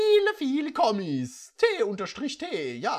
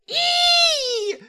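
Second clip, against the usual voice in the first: very high, loud "ih" shriek; hammed-up loud high "ih"; disgusted shriek; 10 dB louder than usual. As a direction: squeal in disgust after the kiss. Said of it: "sagte sie".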